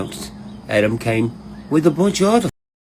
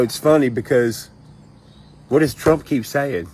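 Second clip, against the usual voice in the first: normal talking voice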